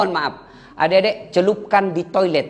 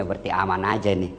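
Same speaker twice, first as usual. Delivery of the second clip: inner thought; 10 semitones lower, 6 dB quieter than usual. Acted. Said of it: "Dalam hati suaminya".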